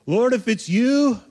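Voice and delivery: hammed-up questioning call; mocking doubt. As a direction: imitate a doubter, drawn out and high. Mocking Peter's needless doubt.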